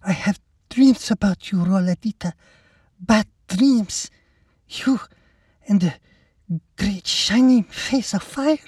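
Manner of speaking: put-on high, husky, halting Mexican accent; thick Mexican accent. A narrator's reading. Mexican woman telling Lacey her bad dreams, husky Mexican accent. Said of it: "the woman husked in a thick accent".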